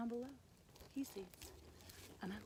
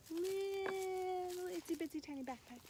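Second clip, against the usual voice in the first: cutesy tiny; 7 semitones higher than usual. A narrator's reading. Storyteller describing a mouse's tiny backpack, high cutesy voice. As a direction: stretch it out high to show tininess.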